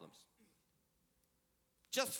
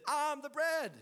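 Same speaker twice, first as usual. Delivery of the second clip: raised, ringing proclamation; preached scripture; emphatic scripture reading; 4 semitones higher than usual. Acted. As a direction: lift each promise up. Reads Jesus' words.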